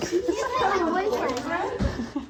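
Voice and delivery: high, loud, slow denial; indignant protest. Acted. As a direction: insist it firmly, offended. Joseph protests to narrator.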